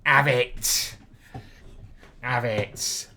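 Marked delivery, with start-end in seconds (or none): 0.0-1.0 louder taunt. Real, not acted. Taunting the opponent.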